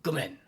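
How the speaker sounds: "gomen" clipped and curt, said loud; "gomen" dropped briefly between male friends. Shown as a demonstration. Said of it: "brief, short, curt".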